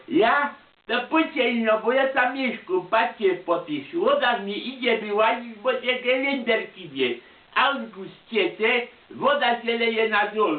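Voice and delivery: put-on high-pitched little old grandpa; old man voice. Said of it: "A on padł". (wysoki głos małego dziadka)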